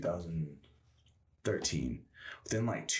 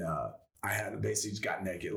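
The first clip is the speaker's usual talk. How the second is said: ordinary talk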